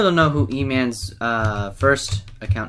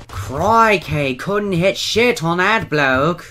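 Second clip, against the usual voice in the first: drawn-out, higher hammed-up Aussie; mock Australian accent; 6 semitones higher, 3 dB louder, much slower than usual. Gamer mocking their own missed shots, mock Australian accent. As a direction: play up an Australian accent, stretching the words.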